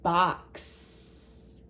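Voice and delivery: Box whispered, the x hissed at the end; whispered Box for little learners; whispered word. Shown as a demonstration. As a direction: breathe out Box softly, stressing the final x. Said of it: "Can you hear the x sound at the end".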